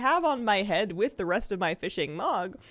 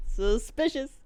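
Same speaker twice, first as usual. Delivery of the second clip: loud, high, suspicious; drawn-out playful suspicion; mock suspicious; 6 semitones higher, 6 dB louder, slower than usual.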